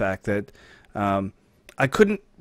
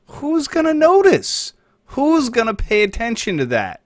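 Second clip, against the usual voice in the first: mock innocent; 13 semitones higher, 7 dB louder than usual. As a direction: ask it like nobody could possibly care. Mock rhetorical question.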